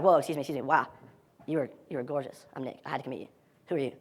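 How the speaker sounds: soft, low, smitten approach; acted awestruck murmur; hushed awe. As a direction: drop low and quiet, as if struck by someone. Acting approach to a stunning woman.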